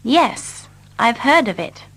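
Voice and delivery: ordinary delivery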